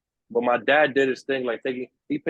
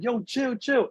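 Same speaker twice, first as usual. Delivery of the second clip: calming him down; 9 semitones higher, slower than usual. Retold or imitated. Calming the armed kid down.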